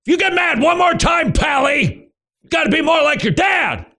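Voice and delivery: yelling at the son for getting mad again; mock angry parent. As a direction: bark it like a fed-up parent. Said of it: "Instead of just".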